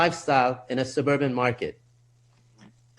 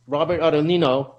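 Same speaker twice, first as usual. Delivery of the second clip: ordinary talk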